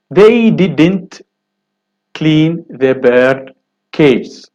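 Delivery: very slow, clean stretched out; slow careful reading. Shown as a demonstration. Teacher reading the first exercise sentence very slowly. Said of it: "first sentence".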